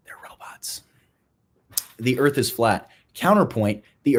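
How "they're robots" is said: very soft, voiceless whisper; hushed secret reveal; conspiratorial whisper. Pretend. Whispered secret punchline after a pause.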